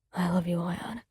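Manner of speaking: soft low murmur of love; whispered. A narrator's reading. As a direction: breathe it out tenderly. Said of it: "he whispered".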